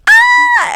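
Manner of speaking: very high, loud shriek; imitated scream. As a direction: mimic a roomful of screaming relatives. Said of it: "Everyone's screaming".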